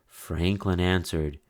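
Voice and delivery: ordinary delivery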